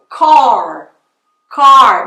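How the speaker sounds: car with a long curled r; English retroflex car. Shown as a demonstration. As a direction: stretch the American r in car. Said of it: "não é car". (car com R americano)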